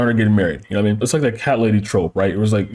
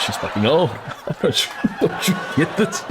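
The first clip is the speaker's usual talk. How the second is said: laughing; 4 semitones higher, 5 dB quieter than usual. Real, not acted. Laughing at his own joke.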